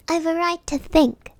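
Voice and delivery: sharp, slightly worried retort. A narrator's reading. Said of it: "said Alice sharply".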